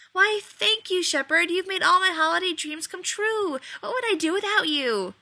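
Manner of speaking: high, loud mock thanks; Rodney mockingly thanks Shepherd for the biscuit; sarcastic gratitude. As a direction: gush with fake delight.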